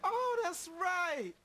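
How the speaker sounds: high, slow oh that's right; remembering with a rising oh; sudden recollection. Acted. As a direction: stretch it out as it dawns on you. Remembers his troll story.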